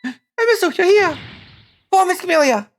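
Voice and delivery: put-on high girl voice. Gamer voicing a worried girl character, high-pitched. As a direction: squeak it up like a little girl character.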